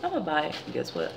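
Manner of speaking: ordinary delivery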